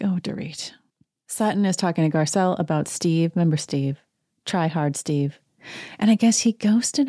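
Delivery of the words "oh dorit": breathy exasperated whisper. Real, not acted. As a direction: whisper it with a sigh. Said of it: "I'm like".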